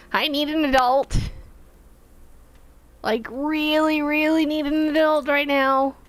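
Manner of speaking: high-pitched whiny plea; hammed-up high-pitched plea for an adult; mock panic. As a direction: squeak it like a scared kid calling for help.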